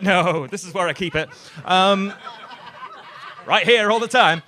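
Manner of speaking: sarcastic retort to a beggar; loud sarcastic reply. Retold or imitated. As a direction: throw it back with sarcasm.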